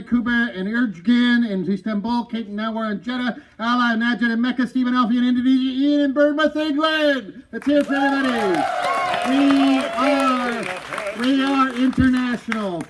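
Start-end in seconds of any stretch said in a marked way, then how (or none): 3.6-12.8 building, louder crowd-rousing call; rousing shout-out. Real, not acted. Host rallying the audience over worldwide viewers, rousing shout. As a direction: pump up the audience, louder and higher.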